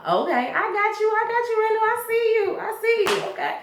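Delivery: a mother half-singing I see you; sarcastic mom. Retold or imitated. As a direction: tease him that you see through him.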